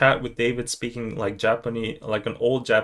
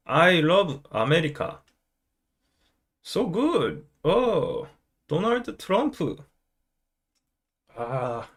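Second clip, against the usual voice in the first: English with lilting Busan intonation, often pitched up; Busan-accented English; 4 semitones higher than usual. Acted. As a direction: play a Busan local trying out English. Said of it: "do the Busan accent in English".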